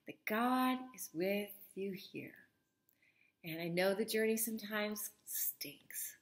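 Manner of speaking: slow, soft, unvoiced confiding; tender whisper. Real, not acted. Comforting solo parents about the hard journey, whispered slowly. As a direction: whisper it gently like a secret shared.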